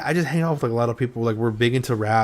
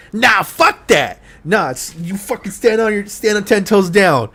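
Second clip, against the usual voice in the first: loud, high-pitched hype rant; Kenji; 9 semitones higher, 7 dB louder than usual. Retold or imitated. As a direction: shout it like rallying someone to stand their ground. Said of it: "Kenji was one of the first VTubers that I saw that was like".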